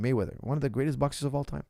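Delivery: usual speaking voice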